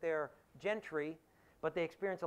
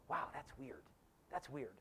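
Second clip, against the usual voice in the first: softer, a quiet puzzled wow; mild bemused aside; 4 semitones lower, 10 dB quieter than usual. Retold or imitated. Modern readers react to the old manners, soft and bemused. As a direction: say it low and bemused.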